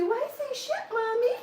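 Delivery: put-on very high toddler voice; small child's high-pitched question; little boy. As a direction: pipe up like a two-year-old asking Mommy. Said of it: "I hear this little boy say".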